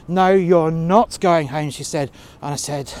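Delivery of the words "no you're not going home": bossy refusal. Retold or imitated. Manager forbids him leaving.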